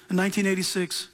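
ordinary talk

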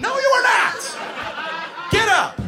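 high, slow drawn-out shouted denial; frantic angry yell. Retold or imitated. Counselor contradicting the child on the ice, frantic yelling. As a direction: shout it down in exasperation.

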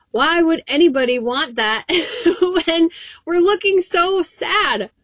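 amused, breaking into laughter; laughing. Real, not acted. Preacher laughing at gloomy Christians, laughing.

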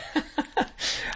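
breathy burst of laughter; unvoiced laugh. Real, not acted. Laughing at a co-host's jab about being forced to listen. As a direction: laugh off the teasing about the show.

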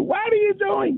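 mock a mother screaming from upstairs; imitated maternal scream. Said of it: "you'd hear screaming from the upstairs".

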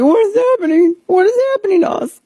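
high, squeaky panic voice; panicked markets voice. Retold or imitated. The markets freaking out in a high squeaky panic. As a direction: squeal it like a frightened crowd. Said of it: "They're like".

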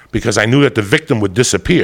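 normal talking voice

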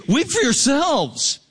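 high, slow, drawn-out command; high-pitched, impassioned admonition; urgent preaching rebuke. Acted. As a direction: preach it as a warning, stretching each word high. Scripture preached as forceful rebuke.

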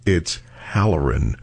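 low, slow deadpan; drawn-out low correction; deadpan correction from coffin. Pretend. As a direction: correct the name slowly from the coffin, dropping low. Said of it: "crane my head towards you and go".

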